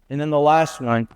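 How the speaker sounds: normal talking voice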